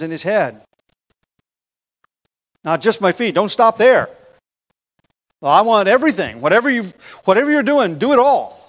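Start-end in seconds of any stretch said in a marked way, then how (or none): 2.6-8.6 high, loud, tumbling plea; eager insistence. Acted. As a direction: act out an over-eager disciple demanding the full measure. Acts out Peter reversing himself eagerly.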